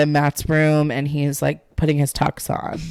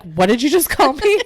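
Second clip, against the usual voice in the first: high-pitched indignant quote; hammed-up offended question; mock affront; 8 semitones higher than usual. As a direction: act out being insulted, pitched way up. Acts out insulted character.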